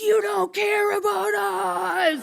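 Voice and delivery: high, drawn-out hysterical wail; driver's meltdown. Retold or imitated. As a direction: play a driver losing it on the phone. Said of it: "this driver's having a complete meltdown".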